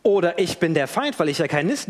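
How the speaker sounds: normal talking voice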